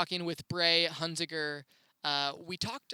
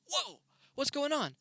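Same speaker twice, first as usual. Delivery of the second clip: startled; 10 semitones higher than usual. Retold or imitated. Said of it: "And I go".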